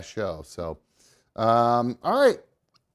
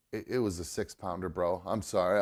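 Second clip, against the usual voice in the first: low, muttered apology; sheepish mumble; 9 semitones lower, 8 dB quieter than usual. Retold or imitated. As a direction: shrug it off quietly, almost embarrassed. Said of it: "And I go".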